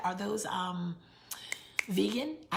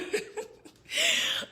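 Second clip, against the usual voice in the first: a high, breathless laugh bursting out; high burst of laughter; 5 dB louder than usual. Real, not acted. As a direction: laugh out loud at your own joke. Laughing at own joke.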